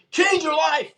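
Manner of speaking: high, loud punchline proclamation; big reveal shout. Retold or imitated. Seminar speaker revealing the secret, high loud shout. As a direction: land the answer like a revelation.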